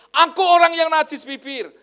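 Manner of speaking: high, loud cry of confession; anguished cry. Acted. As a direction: cry it out as Isaiah crushed by guilt. Preacher voices Isaiah's confession. (seruan keras dan tinggi penuh penyesalan)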